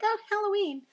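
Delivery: high, bright mock excitement over Halloween; mock delighted gasp. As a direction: jump way up in pitch as if thrilled. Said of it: "I was like".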